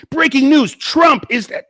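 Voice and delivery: over-the-top shrieking headline; mock TikTok yelling. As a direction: yell it like a hyped TikToker. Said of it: "scream all the time".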